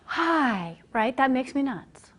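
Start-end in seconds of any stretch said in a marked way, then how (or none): 0.0-0.8 big audible gasp, then hi; inhaling into the greeting. Shown as a demonstration. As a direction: demonstrate a sharp breath before the greeting. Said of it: "then speak on an exhalation, 'cause a lot of people do".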